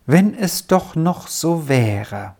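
slow, wistful inner voice; wistful thought. A narrator's reading. Boy remembering earlier feast days, slow and wistful. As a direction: think it longingly, drawn out. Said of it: "er dachte in seinem Herzen".

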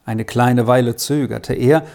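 ordinary delivery, nothing marked

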